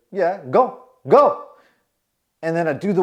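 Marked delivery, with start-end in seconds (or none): 0.1-1.5 impatient dismissal. Retold or imitated. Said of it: "He's like".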